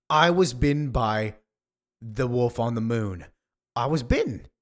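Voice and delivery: projected, theatrical outcry; loud dramatic declaration. Acted. Actor on a sci-fi set revealing a bite, loud and dramatic. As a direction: declaim it loudly with pauses.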